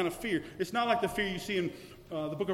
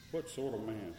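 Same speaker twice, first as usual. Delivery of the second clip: awed hush; 8 semitones lower, 11 dB quieter than usual. Acted. Awed question, dropped very low.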